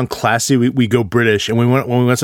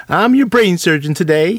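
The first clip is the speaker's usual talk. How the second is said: higher, dopey Southern surgeon; Southern accent; 6 semitones higher than usual. Acted. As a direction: imitate a dumb Southerner claiming to be a surgeon. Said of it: "we go Southern".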